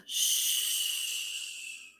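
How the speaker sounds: soft breathy shh; wind whisper. Acted. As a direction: hush like wind in the leaves. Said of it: "It whispers".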